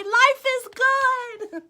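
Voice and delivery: high slow joyful cry; joyful shout. Shown as a demonstration. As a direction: show the kids how to cheer. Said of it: "Can y'all scream that today?".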